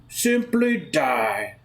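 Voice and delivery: very slow, drawn-out demand; deliberate, unhurried villain's sentence; villainous slow command. Acted. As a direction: stretch out each word as the villain. Villain's final demand to Bobby.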